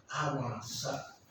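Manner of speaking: slow, low, hushed doubting murmur; devil's whisper. Retold or imitated. Devil whispers doubt to sermon listeners, slow hushed insinuation. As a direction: murmur it softly like a tempter at the ear. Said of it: "iblis ada yang berbisik".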